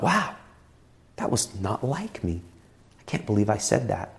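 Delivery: soft, stunned self-reproach; quietly taken aback at your own words; hushed disbelief. Acted. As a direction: say it low, as a thought to yourself. Voicing stunned thought after rude remark.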